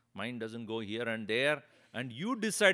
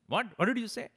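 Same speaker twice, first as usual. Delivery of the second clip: higher, louder offended "what did you say"; indignant outburst; 9 semitones higher, 6 dB louder, faster than usual. Retold or imitated. Audience member bristling at the Swami, indignant and louder. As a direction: demand it back, affronted. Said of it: "that person got very upset".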